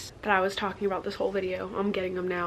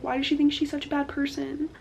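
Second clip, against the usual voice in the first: ordinary delivery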